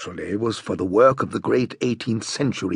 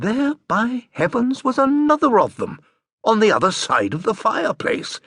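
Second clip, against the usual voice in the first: rising excitement; 9 semitones higher than usual. A narrator's reading. Narrator voicing Boggis spotting a second chair, excited rise. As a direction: let the thrill break through the telling.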